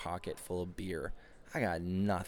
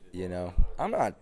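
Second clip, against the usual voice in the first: ordinary talk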